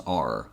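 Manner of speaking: "our" said short like "ar", unstressed; reduced our. Shown as a demonstration. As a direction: clip "our" down to a weak "ar". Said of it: "So our is pronounced as".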